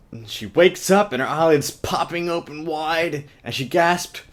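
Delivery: over-the-top storybook telling; dramatic reading. Acted. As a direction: ham up the big awakening.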